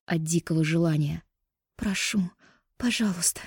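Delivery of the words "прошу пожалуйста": pleading. Acted. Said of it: "громко застонала".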